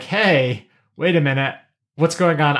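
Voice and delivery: ordinary talk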